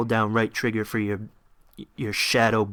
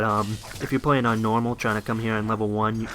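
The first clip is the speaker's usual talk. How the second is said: usual speaking voice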